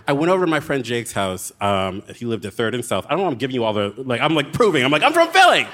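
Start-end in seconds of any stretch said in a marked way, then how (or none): 5.0-5.7 loud, much higher mock-tough "I'm from Philly"; mock tough Philly local. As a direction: puff up and boast like a proud Philly native. Insisting on Philly credentials.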